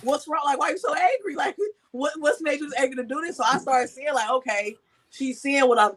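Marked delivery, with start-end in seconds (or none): 0.0-3.4 alarmed mother. Retold or imitated. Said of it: "and I'm like".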